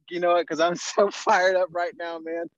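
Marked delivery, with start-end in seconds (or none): none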